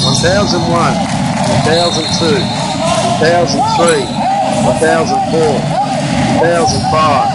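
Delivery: slow, deliberate count of each second; measured one-thousand count. Shown as a demonstration. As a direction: count the seconds out slowly over the film. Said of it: "So I'm gonna count here".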